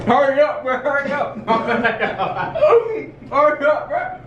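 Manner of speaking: high, laughing, rushing him; urgent laughing. Real, not acted. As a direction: hurry him along, laughing. Urging friend after eating hot chip.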